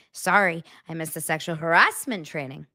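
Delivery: mimicked line with "harassment" swinging up; imitated odd cadence. Showing viewers his unlikely phrasing, pitch rising on "harassment". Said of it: "you wouldn't say it like that".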